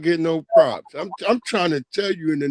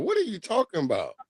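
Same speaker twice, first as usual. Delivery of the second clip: ordinary talk, nothing marked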